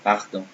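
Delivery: "pardon" with throaty R and nasal ending; French "pardon", pahr-DON. Shown as a demonstration. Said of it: "I said pardon".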